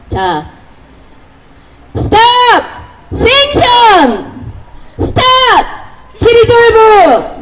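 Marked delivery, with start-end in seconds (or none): 2.0-7.4 high call-and-response rally shouts; 구호 외치기. Real, not acted. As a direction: shout each slogan slowly, stretching it out. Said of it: "한번 해보겠습니다". (높고 길게 외치는 구호)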